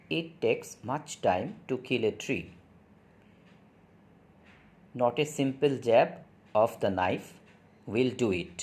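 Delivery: slow, measured poem reading; poem recitation. Acted. Teacher reading poem aloud to students, slow recitation. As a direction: recite line by line, very slowly with pauses.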